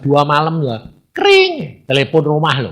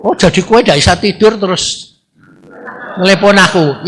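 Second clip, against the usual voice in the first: 1 annoyed retort; 5 semitones higher than usual. Retold or imitated. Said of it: "saya kan jadi jengkel".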